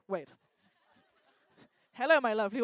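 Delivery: ordinary talk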